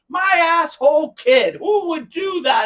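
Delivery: high, loud, slow imitation of dad's outrage; mock exasperation. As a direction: play an exasperated dad blaming his kid. Dad's exasperated answer acted out.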